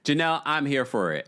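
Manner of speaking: ordinary talk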